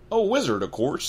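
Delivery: loud high hearty tone; obviously, cheerful; hearty Hagrid. Acted. Hagrid assuring Harry, hearty and loud.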